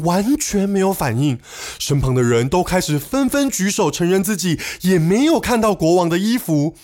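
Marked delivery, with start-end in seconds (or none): none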